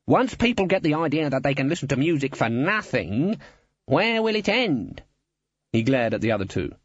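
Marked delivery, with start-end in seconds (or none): 0.1-5.0 high ranting voice, climbing higher; indignant guild boss. A narrator's reading. Guild boss fretting over music for nothing, indignant.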